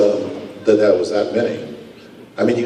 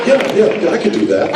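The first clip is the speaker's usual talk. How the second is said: mock eager agreement; 11 semitones higher, 3 dB louder, faster than usual. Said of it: "is that I said".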